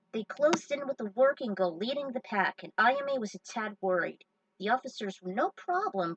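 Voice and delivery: normal talking voice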